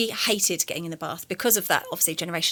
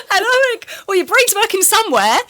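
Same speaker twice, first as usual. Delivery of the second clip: much higher and louder, laughing through the words; 13 semitones higher, 9 dB louder than usual. Real, not acted. Carer laughing at her nan's sharp insult, loud and high. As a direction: crack up mid-line.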